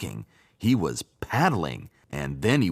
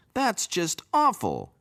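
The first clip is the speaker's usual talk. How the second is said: pond frogs; 11 semitones higher than usual. A narrator's reading. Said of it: "said the frogs".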